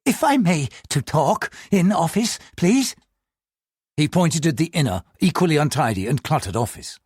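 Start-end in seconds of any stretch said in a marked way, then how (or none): 0.1-3.0 high, hesitant broken English; a nervous clerk searching for words; halting, nervous English. Acted. Clerk asks the officer for a private word in halting English. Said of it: "in halting, nervous English".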